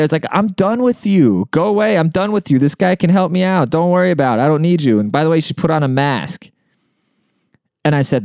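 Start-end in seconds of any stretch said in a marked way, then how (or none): 0.3-6.5 high-pitched, exasperated dismissal; fed-up telling-off. Retold or imitated. Customer tells off an interfering clerk in front of everyone, exasperated. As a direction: send them away, voice raised high in irritation.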